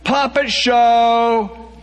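high, loud, drawn-out shout. A narrator's reading. Brit swinging the thing, shouting high and slow. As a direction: belt it slowly, high and loud.

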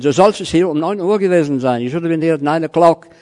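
high, loud scolding German boss; stern reprimand. Retold or imitated. German editor reprimanding late Italian, high and loud. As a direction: drive home the nine o'clock rule.

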